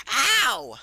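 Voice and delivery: sharp high yelp of pain; pained scream. Acted. Racer crying out in pain, high and loud.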